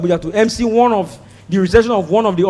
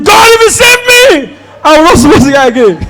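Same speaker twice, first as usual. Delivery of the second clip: high, loud pleading vow; shouting vow; 5 semitones higher, 4 dB louder than usual. Retold or imitated. Smoker bargaining with God mid-crash, loud pleading. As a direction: yell the promise to God. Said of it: "That guy that was shouting".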